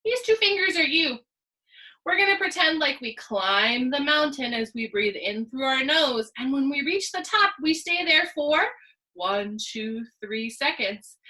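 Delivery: ordinary talk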